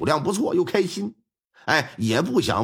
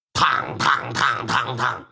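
gong imitation; 5 semitones lower, slower than usual. Gong beaten to rouse village.

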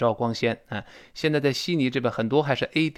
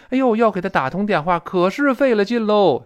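higher, louder sighing "哎呦" grumble; exasperated complaint; 7 semitones higher, 5 dB louder than usual. Retold or imitated. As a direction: groan about the hassle. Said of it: "是这么来说".

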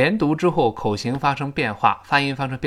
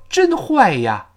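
much higher, louder "真坏呀", slow; exclaimed 真坏呀; 13 semitones higher, 5 dB louder, slower than usual. Shown as a demonstration. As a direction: cry out "真坏呀" drawn out. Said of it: "你要说". (拔高拖长“真坏呀”)

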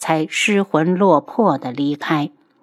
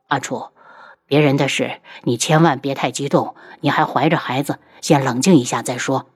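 soothing husband; 5 semitones lower than usual. Acted. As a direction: gently talk her down. Voices the husband calming his wife. (低声安抚妻子)

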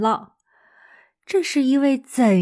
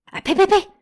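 high, loud startled spitting; mother hushing an unlucky remark; alarmed mother; 10 semitones higher, 5 dB louder, much faster than usual. Acted. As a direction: ward off bad luck in a rush. Mother scolds bad omen.

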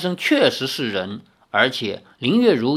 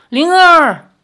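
much higher, loud cry; shouting her name; 14 semitones higher, 10 dB louder than usual. A narrator's reading. Li Xiaoyao shouts for the missing Ling'er, loud. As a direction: yell the name in panic. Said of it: "李逍遥叫道".

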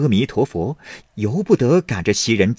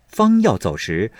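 plain reading voice

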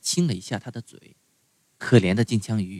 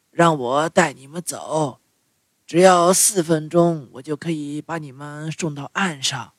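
tuna voice; 4 semitones higher than usual. A narrator's reading. Tuna speaks.

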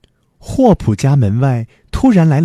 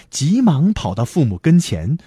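ordinary delivery

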